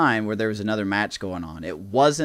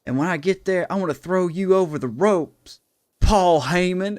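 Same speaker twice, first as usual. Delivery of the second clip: raised, hyped challenge to the manager; wrestler threat; 8 semitones higher, 5 dB louder than usual. Retold or imitated. R-Truth threatening Paul Heyman in the ring, hyped shout. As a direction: call him out big, like a ring promo. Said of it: "he goes".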